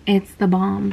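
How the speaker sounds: ordinary delivery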